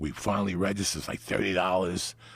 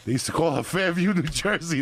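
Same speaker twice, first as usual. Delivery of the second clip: mock shout; 10 semitones higher, 5 dB louder than usual. Repeats nickname louder.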